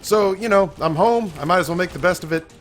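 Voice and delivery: hoarse, throat needs clearing; frog in throat. Real, not acted. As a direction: talk through a frog in the throat. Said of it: "I've got this frog stuck in my throat".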